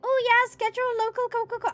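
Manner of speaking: high, playful influencer voice. Pretend. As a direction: pitch it right up as a playful advert. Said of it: "I don't know why I pitched up".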